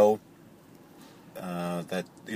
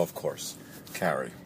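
ordinary talk, nothing marked

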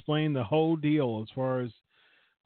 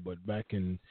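ordinary talk, nothing marked

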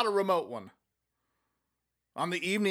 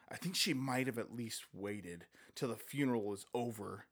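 a hint of shocked disapproval, softer; lightly scandalized aside; 4 semitones lower, 7 dB quieter than usual. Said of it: "in a scandalized voice".

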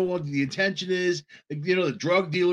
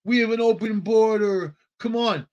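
lightly voiced mock invitation, higher; a hint of a beckoning politician; mocking Biden's talk; 6 semitones higher than usual. Mocking Biden inviting migrants, lightly voiced and higher. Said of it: "That's the way Biden talks".

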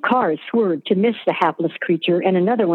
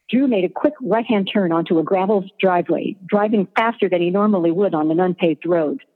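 plain reading voice

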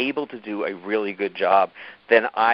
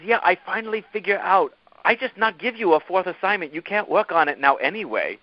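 imitated Korean accent, pitched higher; higher voice, mimicking a Korean boss; Damiana's Korean accent; 7 semitones higher than usual. Korean boss announcing her fix to Milo, higher imitated Korean accent. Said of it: "impersonate her without doing her accent".

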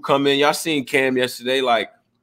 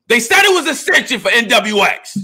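high, loud-leaning excited crowing; amused high-pitched outburst; hyped exclamation; 11 semitones higher, 4 dB louder than usual. Real, not acted. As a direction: crow it with delight, voice pitched way up. Hyped reaction to last night's battle.

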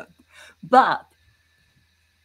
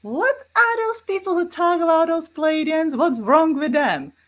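mocking skeptic; 10 semitones higher, 4 dB louder than usual. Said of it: "people who would say, like".

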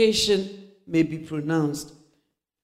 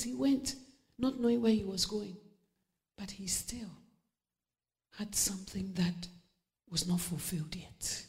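whispered, much softer sermon lines; hushed preaching; 11 dB quieter than usual. Acted. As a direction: draw them in with a whisper.

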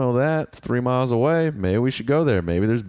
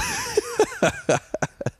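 high laugh; 4 dB quieter than usual. Real, not acted. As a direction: burst of squeaky laughter. Reacts to golf story.

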